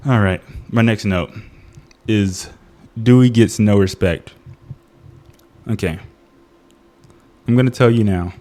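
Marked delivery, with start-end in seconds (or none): none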